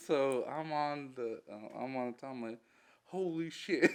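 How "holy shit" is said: mock shock, higher, breaking into laughter; laughing retold shock. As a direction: play the panic for laughs. Funny retelling of the bomb blast.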